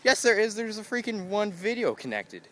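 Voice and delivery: indignant high retort to iTunes; exasperated retort. Retold or imitated. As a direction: snap back at the software. Arguing with iTunes.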